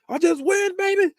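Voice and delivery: high, strained cocky-athlete voice; Lewis. Retold or imitated. Cocky football player lifting weights, high strained boast. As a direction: boast it through a straining squeal. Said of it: "he's like".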